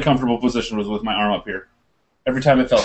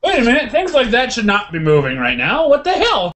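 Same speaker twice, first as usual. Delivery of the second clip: higher, louder rising panic; alarmed realization; 10 semitones higher, 4 dB louder than usual. Retold or imitated. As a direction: hit the alarm as you realize the bone is moving. Realizing his shoulder bone moves.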